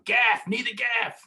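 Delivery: high, slow loud call. Retold or imitated. Mate at the back of the boat calling for the gaff. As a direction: call for the gaff across the deck. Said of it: "the guy's calling".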